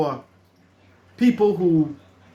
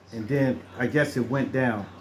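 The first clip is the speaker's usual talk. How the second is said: usual speaking voice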